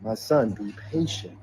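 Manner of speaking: soft, slow, soothing words of reassurance; relayed message spoken softly and slowly; gentle divine voice. Retold or imitated. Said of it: "So I received it as".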